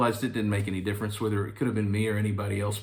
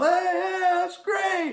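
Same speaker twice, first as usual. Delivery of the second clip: impressed producers; much slower than usual. Retold or imitated. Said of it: "They're all".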